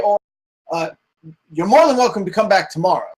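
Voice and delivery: nervous villager. Acted. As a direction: hurry the stranger away politely. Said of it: "kinda looks at you and goes".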